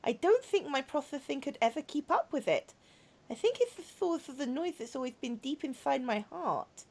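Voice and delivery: soft, earnest confession; quiet robot girl baring her feelings; tender robot girl. Acted. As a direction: speak gently from a troubled heart. Voices a robot girl in game.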